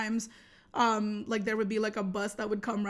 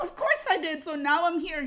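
high, louder retold confession; exasperated admission; 6 semitones higher, 5 dB louder than usual. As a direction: own up in a raised, worked-up voice. Retells exasperated confession to friend.

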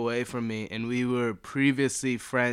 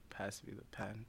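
desperate whisper; 3 semitones lower, 20 dB quieter than usual. Retold or imitated. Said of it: "You're like".

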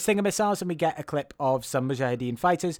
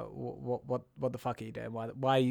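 hushed; 3 semitones lower, 10 dB quieter than usual. Retold or imitated. Hoffman pulls Hanks aside.

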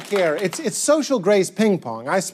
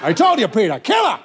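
proud dad. Acted. Dad boasting to son about his joke, elated. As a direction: crow over a joke that landed. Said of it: "He's gonna be so happy you laughed".

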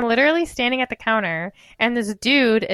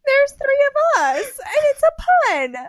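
high, loud, insistent defence of the pun; excited pleading; 3 dB louder than usual. Retold or imitated. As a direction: squeal it like the joke is obviously great.

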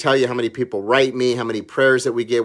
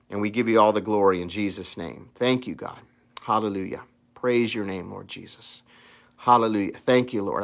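quiet prayer; 4 semitones lower, 4 dB quieter than usual. Acted.

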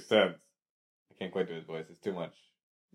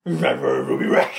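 ordinary talk, nothing marked

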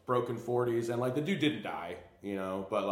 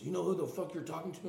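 higher, put-on tough-guy challenge; tough-guy threat; 8 semitones higher than usual. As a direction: puff up like a street tough. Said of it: "he goes".

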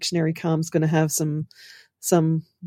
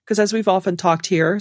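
normal talking voice

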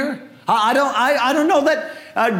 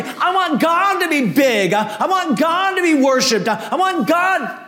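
higher, fervent preaching as Elijah; passionate Elijah; 5 semitones higher than usual. Acted. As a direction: pour out Elijah's zeal for God.

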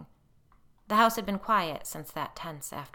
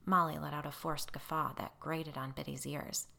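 ordinary delivery

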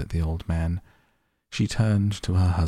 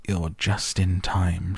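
ordinary delivery, nothing marked

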